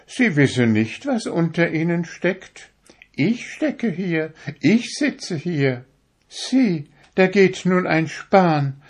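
high, proud little needle voice; a needle showing off how fine it is; vain darning needle. A narrator's reading. To the fingers, a vain needle in a high proud voice.

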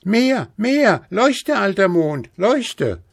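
put-on high, loud childish command; schreiend. A narrator's reading. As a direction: shout orders up at the Moon. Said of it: "schrie Häwelmann". (hoch und laut befehlend geschrien)

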